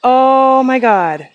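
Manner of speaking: slow, loud oh my God; drawn-out exclamation. Real, not acted. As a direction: stretch it out in awe. Still overwhelmed by the find.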